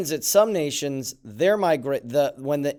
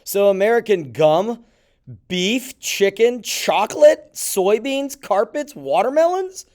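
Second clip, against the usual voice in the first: incredulous list; 5 semitones higher, 7 dB louder than usual. Real, not acted. Listing tariffed goods in surprise.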